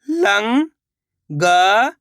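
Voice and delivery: Langar stretched very slowly, rising at the end; slow drawn-out reading. Shown as a demonstration. Teacher sounding out langar for pupils, very slow and rising. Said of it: "शब्द को आपको खुद से पढ़ना है".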